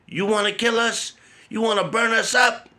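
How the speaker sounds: imitating his mother yelling at him; furious mom. Mother scolding child after fire.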